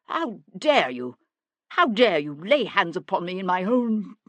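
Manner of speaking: loud, affronted bluster; offended and forceful; outraged protest. A narrator's reading. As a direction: protest the insult with rising indignation. Grabbed man protests in outrage.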